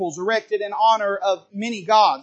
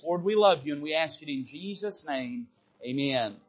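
softer, lower, hushed prayer voice; 4 semitones lower, 4 dB quieter than usual. Acted. Pastor leading the congregation in prayer, hushed and reverent. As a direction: pray humbly on behalf of the church.

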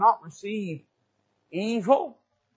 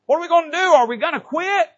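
high, louder preacher's call; preaching challenge; 10 semitones higher, 7 dB louder, faster than usual. Acted. Preacher challenging the church not to quit. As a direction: fling the question at them.

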